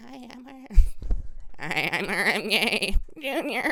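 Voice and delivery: high, slow imitating RFK Junior; RFK impression. Host does his RFK Junior voice, high and slow. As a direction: stretch it out high like a mock RFK. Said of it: "This is my R F K impression".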